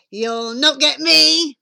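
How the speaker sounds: loud little pig's taunt; cocky piggy cry; defiant pig. Acted. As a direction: call it out boldly to the wolf. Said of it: "the piggy cried".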